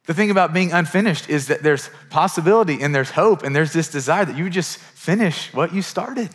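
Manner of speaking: normal talking voice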